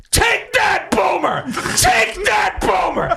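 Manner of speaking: high-pitched mock shout at a camera; retold triumphant scream; imitated screaming. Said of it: "starts screaming into the camera".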